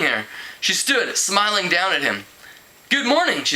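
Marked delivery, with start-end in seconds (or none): 2.9-3.4 bright greeting. Acted. Irina greets cheerfully.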